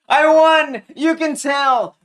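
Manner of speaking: high, loud victory shout; elated gamer celebrating; triumphant. Real, not acted. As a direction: crow loudly over the win. Celebrating a win in game.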